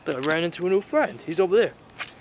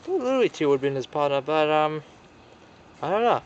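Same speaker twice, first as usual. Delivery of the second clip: ordinary delivery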